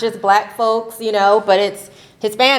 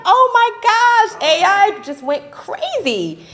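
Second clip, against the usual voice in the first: mock dismay; 12 semitones higher than usual. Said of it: "And I'm like".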